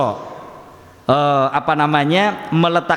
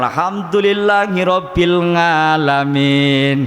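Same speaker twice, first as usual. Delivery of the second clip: put-on sung slowly in a Javanese accent, "ngalamin" with a nasal ng for the ain; slow chanted Javanese recitation, "ngalamin" with ng; slower than usual. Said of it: "yang Jawa".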